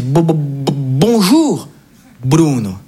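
stammering, lightly played emotional greeting; choked with emotion. Grandfather greeting his grandson at the airport, mildly moved. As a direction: imitate a moved grandfather stumbling into hello. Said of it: "il est tout ému".

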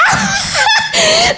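high, hammed-up crying outburst; mock wail. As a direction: burst into a fake mother's wail. Mother threatens to cry herself.

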